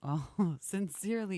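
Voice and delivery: ordinary talk, nothing marked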